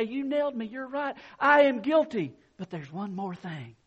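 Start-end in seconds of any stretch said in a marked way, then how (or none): 0.0-2.3 mock confession. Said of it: "agree and say".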